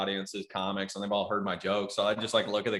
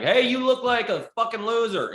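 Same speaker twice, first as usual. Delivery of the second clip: high, mocking heckler jab; heckler insult; 13 semitones higher, 5 dB louder than usual. Comic mocks a fellow comic in a high heckling voice. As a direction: sneer at the newbie from the back. Acting out heckling an audience member.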